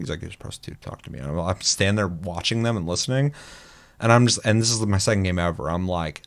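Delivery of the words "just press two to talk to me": hushed, voiceless aside; retold whisper. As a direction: whisper it like a secret instruction. Retold secret talk between players.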